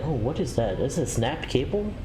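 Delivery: ordinary delivery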